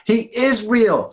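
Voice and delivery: high, loud and slow, pushing the words; insistent. Acted. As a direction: insist he's telling the truth. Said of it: "says Ben".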